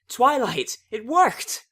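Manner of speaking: high, loud joyful call; excited cheer. Acted. Friends cheering as Twilight wakes up, excited shout. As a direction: greet her waking with delight.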